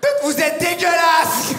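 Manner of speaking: high, slow, tutting scorn; hammed-up outraged cashier; disgusted cashier. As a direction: scold him with prim disgust.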